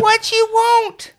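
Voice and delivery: high, loud, slow call; distant call. Acted. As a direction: drawl it out from far off. Fairy calls from far away.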